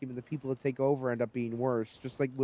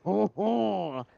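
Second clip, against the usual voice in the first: put-on French Canadian accent; 5 semitones higher than usual. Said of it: "a little tinge of that".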